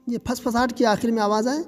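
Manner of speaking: ordinary delivery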